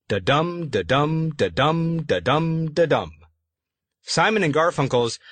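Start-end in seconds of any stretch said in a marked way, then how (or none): 0.1-3.1 "da-dum" said five times, weak first beat and stressed "dum", steady rhythm; iambic beat. Shown as a demonstration. Author showing listeners the iambic rhythm, stressing every "dum". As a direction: tap out the iambic pulse, stressing each "dum". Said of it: "a line of iambic pentameter sounds like this".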